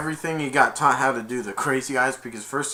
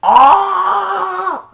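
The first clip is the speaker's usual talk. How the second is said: very high, loud shriek; screaming cry; 14 dB louder than usual. Retold or imitated. Principal filmed on the toilet, very high loud shriek. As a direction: shriek in panic. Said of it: "he's like".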